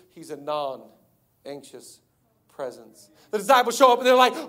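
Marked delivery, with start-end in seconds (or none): 0.1-3.1 low, soft, slow and unhurried; non-anxious calm. Acted. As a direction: settle right down into total calm. Jesus calm against Peter's cry.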